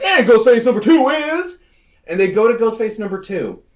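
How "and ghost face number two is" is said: loud, high game-show style announcement; hammed-up villain unveiling, pitched high and loud; mock dramatic reveal. Killer announcing his partner, mock dramatic reveal.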